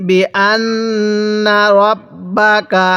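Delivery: very slow, drawn-out syllables; model recitation. Shown as a demonstration. Teacher models Quran words for learners, slow measured recitation. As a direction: stretch each long vowel evenly. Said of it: "আমার সাথে মিলিয়ে পড়ুন".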